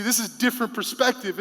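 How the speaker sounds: ordinary delivery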